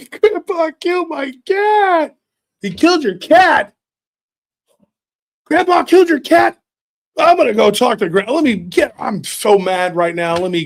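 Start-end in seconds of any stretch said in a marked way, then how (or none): 0.1-2.2 high, slow mock sobbing; crying child. Billy crying to his dad about the cat, high and sobbing. 2.6-8.6 high incredulous question; shocked dad. Retold or imitated. Dad learning grandpa killed the cat, high and shocked.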